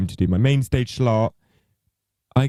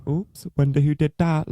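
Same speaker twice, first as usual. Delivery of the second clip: high, mocking sing-song sarcasm; sarcastic; 7 semitones higher than usual. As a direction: tease like you did it. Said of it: "very sarcastically".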